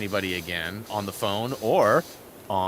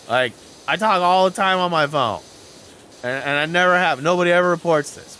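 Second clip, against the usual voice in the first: defensive; 5 semitones higher, 7 dB louder than usual. Retold or imitated. Arguing back with his dad.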